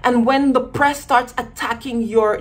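usual speaking voice

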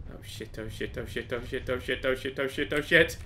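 low, hushed run of curses building slightly; nervous muttering. Real, not acted. Player in sudden danger, nervous muttering. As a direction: mutter it over and over under pressure.

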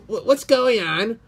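usual speaking voice

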